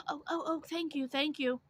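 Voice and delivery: high, fawning imitated thanks. Imitating the beggar thanking her after a refusal, high fawning voice. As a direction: mimic her overdone gratitude. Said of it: "She's like".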